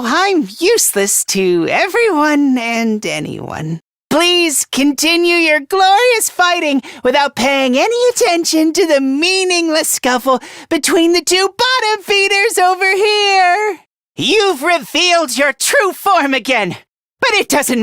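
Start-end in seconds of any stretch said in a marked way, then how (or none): 4.1-13.8 high, projected plea climbing at the end; calling out. Acted. Urging comrades to ignore the bottom feeders' scuffle, calling out. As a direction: call across a battlefield in one long breath.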